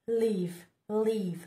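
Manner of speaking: ordinary talk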